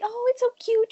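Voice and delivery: imitating a gushing newbie; mock cutesy. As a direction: coo it like it's adorable. Mocking her early naive self.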